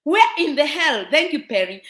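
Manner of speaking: ordinary delivery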